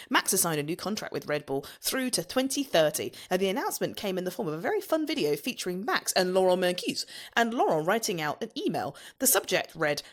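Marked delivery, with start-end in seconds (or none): none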